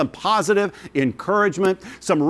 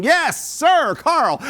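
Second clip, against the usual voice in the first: excited shout; 11 semitones higher, much slower than usual. Real, not acted. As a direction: celebrate the bid at the top of your voice. Auctioneer hails a new bid.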